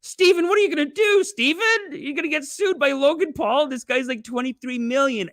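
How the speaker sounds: high, loud fretting; mock panic. Host fretting that Steven gets sued, mock panic.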